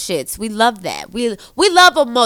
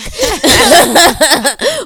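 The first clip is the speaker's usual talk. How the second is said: laugh; 6 semitones higher, 7 dB louder than usual. Real, not acted. Laughs at own joke.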